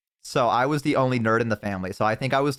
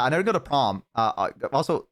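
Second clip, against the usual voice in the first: ordinary talk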